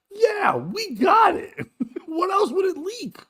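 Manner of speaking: high, drawn-out comic protest; tickled, incredulous high voice; laughing exasperation. Real, not acted. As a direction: cry it out through laughter. Mocking the needless explanation, laughing.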